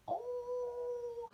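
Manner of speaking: high startled oh; surprised gasp. Real, not acted. Hearing that Mateo punched Peck, high surprised oh. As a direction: gasp like it just happened.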